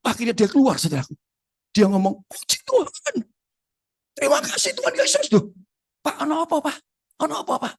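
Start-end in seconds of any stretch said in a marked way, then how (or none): 2.2-5.3 very high-pitched cry of praise; re-enacted desperate, relieved outcry; overwhelmed thanksgiving. Acts friend crying out thanks. 6.0-7.7 high-pitched, concerned asking; alarmed questioning. Retold or imitated. Acts himself asking alarmed friend.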